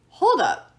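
loud, high hold up; cutting in. Acted. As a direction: stop everyone short, loud. Leo cutting in.

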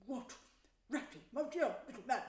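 surprised, delighted Badger; character voice of astonished welcome; quite different, welcoming voice. A narrator's reading. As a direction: turn from grumpy to fond on recognising a friend. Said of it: "in quite a different voice".

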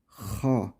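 Ha, hırıltılı boğaz sesi; Ha'yı gırtlaktan hırıltıyla; throaty ha. Shown as a demonstration. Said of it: "Ha'nın hırıltısı duyulacak". (hırıltılı Ha)

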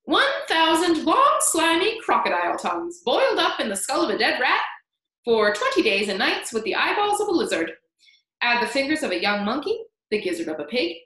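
put-on loud, excited old man reciting a recipe; crying out. A narrator's reading. Old man waving his stick at James, loud excited recital. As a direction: rattle off the grisly ingredients with relish. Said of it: "he cried".

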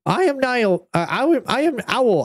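jittery high owl; nervous owl. A narrator's reading. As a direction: try to sound brave and fail. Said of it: "puffed out his feathers and said".

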